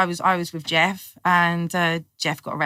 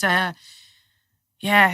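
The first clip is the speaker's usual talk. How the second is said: normal talking voice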